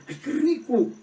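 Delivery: high, squealing imitation of pleasure; delighted exclamation. Preacher imitating a friend who loves every gift, delighted. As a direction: play the friend's delight big. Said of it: "con una cara de satisfacción".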